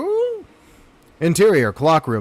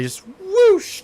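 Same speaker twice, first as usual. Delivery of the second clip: whoosh sound. Retold or imitated. Rocket sound.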